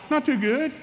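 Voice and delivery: put-on high, laughing kid's confession; sheepish son. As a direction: own up with a guilty grin.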